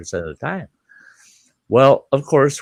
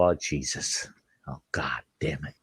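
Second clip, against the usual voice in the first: soft, low grumbling curse; muttered dread; 7 semitones lower, 12 dB quieter than usual. Retold or imitated. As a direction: mutter it under your breath.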